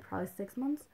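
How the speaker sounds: ordinary talk, nothing marked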